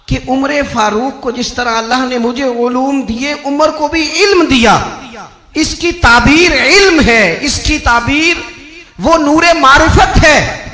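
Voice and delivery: high, loud, ringing proclamation; fervent sermon climax at full voice; full-force preaching. Real, not acted. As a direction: drive each phrase home to the congregation.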